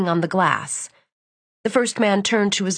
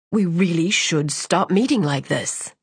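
put-on cold young man; 2 semitones lower than usual. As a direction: deliver it dry and cold. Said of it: "His voice lacked its usual mirth".

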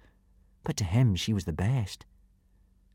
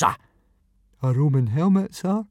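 put-on sheepish boy; 6 semitones higher, slower than usual. A narrator's reading. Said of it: "admitted Fishlegs".

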